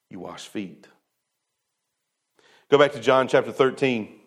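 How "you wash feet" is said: soft and low, almost spoken aside; quiet answer. Real, not acted. Preacher quietly answering how disciples should live. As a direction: drop the voice for the simple answer.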